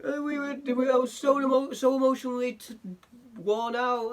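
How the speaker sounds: high, whimpering mock-apology voice. YouTuber mocking the mum's apology video, high whimpering whine. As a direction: whine out feeble excuses in a squeaky voice. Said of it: "and it was like".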